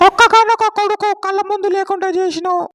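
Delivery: high-pitched wife berating her husband; mother character voice. Acted. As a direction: play the angry mother defending her son. Man voices angry mother character.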